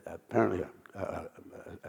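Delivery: usual speaking voice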